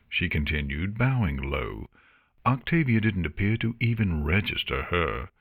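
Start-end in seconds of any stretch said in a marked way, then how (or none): none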